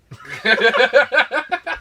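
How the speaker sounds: high loud burst of laughter. Real, not acted. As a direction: crack up loudly, high and far. Bursts laughing at retold moment.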